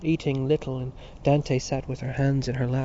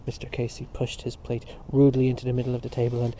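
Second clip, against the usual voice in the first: ordinary delivery, nothing marked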